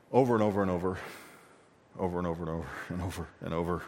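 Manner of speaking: fast, soft, tumbling run of words; rushed repetition. Real, not acted. Preacher showing Israel's endless forgetting with a fast, soft tumble. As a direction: rattle it off to show it never ends.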